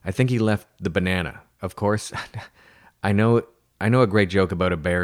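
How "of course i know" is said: ordinary delivery, nothing marked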